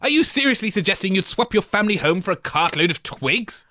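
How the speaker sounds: normal talking voice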